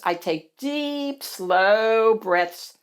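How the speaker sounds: slow, stretched words; calming demonstration. Acted. Bonnie teaching Hattie to calm down, slow delivery. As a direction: draw out each word like a breath.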